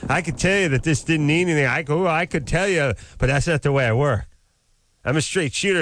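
imitating a cocky blowhard. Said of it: "I like this blowhard".

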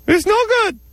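very high squeaky puppet shout; ferret voice. Pretend. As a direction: squeal it like an angry little puppet. Vagina puppet argues with woman.

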